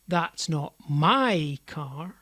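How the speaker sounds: slow, MY swelled and drawn out, rising at the end; stress on MY. Shown as a demonstration. Teacher demonstrates emphasis to learners, stress on "my". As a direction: lean heavily on "my". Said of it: "emphasising and de-emphasising different parts".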